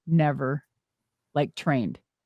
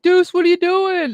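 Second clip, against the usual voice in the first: put-on much higher, bright eager hail of an old friend; excited greeting; 12 semitones higher, 4 dB louder than usual. [name] calling Deuce out of the blue, excited hello. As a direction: call her name out with surprise.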